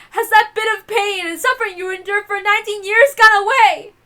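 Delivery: high, loud bitter shouting; bitter outburst. Acted. Natsuhi furiously confronts her unseen tormentor. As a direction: fling the question at your tormentor.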